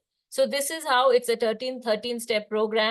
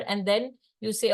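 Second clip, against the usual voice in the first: normal talking voice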